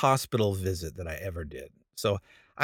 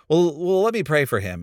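hurried, raised, flustered offer to pray; flustered panic; 5 semitones higher, 5 dB louder than usual. Retold or imitated. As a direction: scramble to cover the gaffe. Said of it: "I just panic".

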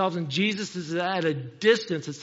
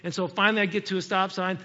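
ordinary talk, nothing marked

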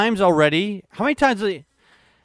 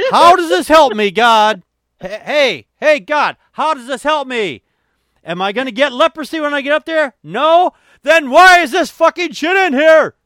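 loud, higher exasperated rant; ranting at God; 8 semitones higher, 7 dB louder than usual. Acted. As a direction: shout your complaint to the sky. Yelling at God.

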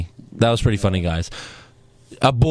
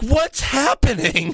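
high, drawn-out mock bewilderment; JoJo; 9 semitones higher, slower than usual. Podcaster mocks interviewer baffled by wrestler's laughing, high drawl. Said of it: "JoJo's like".

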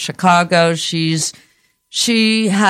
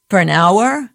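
ordinary delivery

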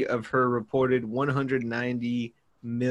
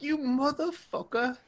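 voicing her angry look; 12 semitones higher, 7 dB quieter than usual. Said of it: "That look on her face is like".

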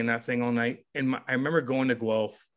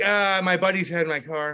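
higher, louder mock excuse; sheepish excuse; 5 semitones higher, 4 dB louder than usual. Player to coaches in his truck, sheepish excuse. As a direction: scramble to explain, lightly acted.

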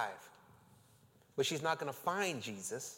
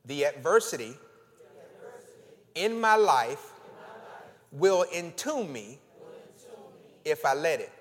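1 declaration; 6 dB louder than usual. Acted. Congregation repeats declaration.

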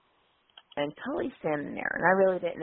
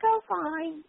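lightly high, airy oh fine; a hint of a ladylike voice; high ladylike reply; 11 semitones higher than usual. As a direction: answer daintily, a bit higher. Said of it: "He's like".

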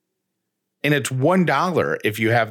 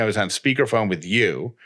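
ordinary delivery

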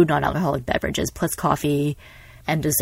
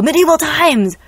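mock excited; 10 semitones higher, 7 dB louder than usual. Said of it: "they go".

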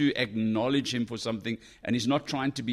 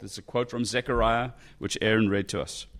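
ordinary talk

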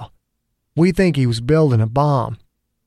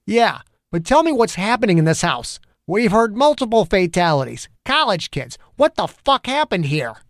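building heated cop rant, pitched up; angry rant; 8 semitones higher than usual. A narrator's reading. Angry detective venting at the federal agent. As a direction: vent the whole bad day at him. Zimmer demands.

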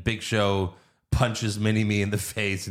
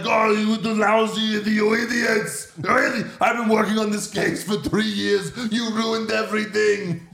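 Arnold impression; 9 semitones higher than usual. Podcaster imitates Arnold scolding the cops in an Austrian accent. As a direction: bark at the cops like Schwarzenegger. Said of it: "he's like".

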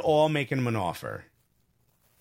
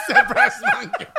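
very high, loud laughing squeal; 6 dB louder than usual. Real, not acted. Laughing squeal reading chat.

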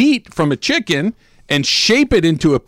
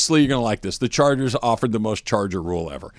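ordinary delivery

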